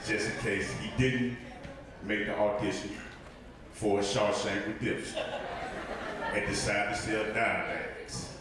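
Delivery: lightly held low narrator voice; Morgan Freeman impression. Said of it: "that's my Morgan Freeman impression".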